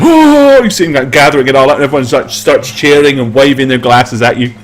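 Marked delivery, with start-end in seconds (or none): 0.0-0.6 high, loud delighted gasp; barman's glad exclaim; excited oh. Acted. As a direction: yelp at the gold coins. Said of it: "he's like".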